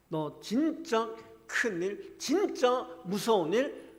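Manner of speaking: God loudly warning of the trials to come; God's grave warning. Retold or imitated. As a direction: stretch each phrase as a dire warning. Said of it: "하나님 우리에게 말씀하시는 겁니다".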